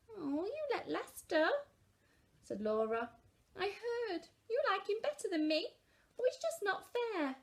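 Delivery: put-on high, hurt complaining girl; whiny child. A narrator's reading. Laura complaining to Mum, high whiny child. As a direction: whine like a jealous kid. Child sulking in read story.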